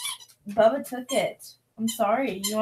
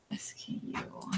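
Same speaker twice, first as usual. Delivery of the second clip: whisper; 18 dB quieter than usual. Real, not acted. Whispered while working.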